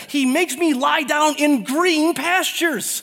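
high, slow, sing-song recitation of the psalm; lifted psalm recital. Acted. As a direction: lift the psalm like a chant.

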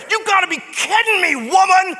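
high, loud, fast indignant yell; outraged shout. Acted. Disciples scolding the woman, furious shout. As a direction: roar it like a snorting horse. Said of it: "They were foaming at the mouth".

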